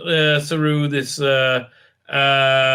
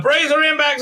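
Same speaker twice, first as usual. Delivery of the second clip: urgent order; 12 semitones higher than usual. Acted. Acted order during crash.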